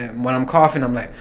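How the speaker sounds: normal talking voice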